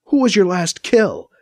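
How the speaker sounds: slow, accusing question; some venom. A narrator's reading. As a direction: ask it with a touch of venom. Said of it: "Anita says with some venom".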